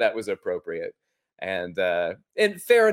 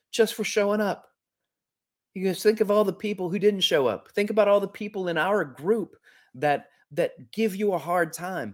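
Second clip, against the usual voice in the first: imitating coach Raj; 7 semitones higher than usual. Raj hyping him about showing up, retold higher. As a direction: coach Raj's pep talk retold a bit higher. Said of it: "he looks at me and he goes".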